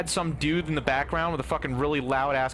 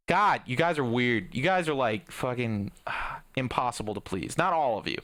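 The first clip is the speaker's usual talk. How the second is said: usual speaking voice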